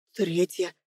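very soft, low one-word answer; near whisper. A narrator's reading. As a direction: barely get it out, almost whispering. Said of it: "почти шёпотом ответил". (почти шёпотом)

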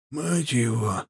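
lightly voiced hoarse old highlander; a hint of low, husky old man. A narrator's reading. Old highlander explaining Karim's looks, low and hoarse. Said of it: "своим низким хриплым голосом".

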